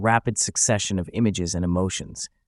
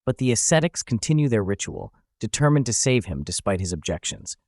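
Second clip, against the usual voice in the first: ordinary delivery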